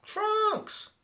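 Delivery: high, sudden call of a name; calling Trunks. Retold or imitated. Mai calls out to Trunks.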